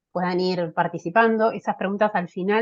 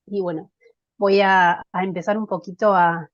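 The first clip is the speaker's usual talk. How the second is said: usual speaking voice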